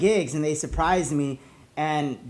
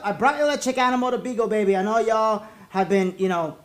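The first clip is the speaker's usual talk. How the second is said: normal talking voice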